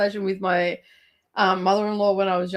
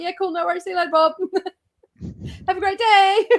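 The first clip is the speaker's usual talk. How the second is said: chirpy farewell; 9 semitones higher, 3 dB louder, faster than usual. Retold or imitated. Cheerful farewell to the husband.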